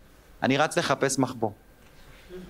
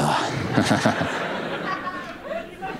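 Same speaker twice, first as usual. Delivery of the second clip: put-on squeaky childish shriek-laugh; high squeal of a laugh. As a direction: let out a thin high squeal. Kid reacting.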